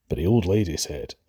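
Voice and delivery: usual speaking voice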